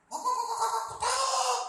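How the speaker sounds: very high, loud cackling hen imitation; clucking hen. Shown as a demonstration. Preacher imitating an egg-laying hen, loud clucking. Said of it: "Itu, itu bertelur ya".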